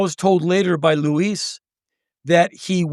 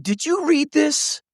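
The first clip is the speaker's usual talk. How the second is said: higher, slow teasing ask; teasing question; 10 semitones higher than usual. Retold or imitated. A friend teasing Henry about Mark's quote, higher and slow. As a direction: dangle the gossip slowly. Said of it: "someone said".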